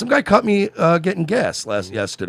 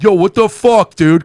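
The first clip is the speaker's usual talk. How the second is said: mock confrontation; 6 semitones higher, 8 dB louder, slower than usual. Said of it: "been like".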